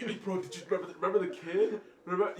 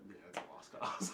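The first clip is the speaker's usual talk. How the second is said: quiet; 12 semitones lower, 11 dB quieter than usual. Retold or imitated. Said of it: "he's like".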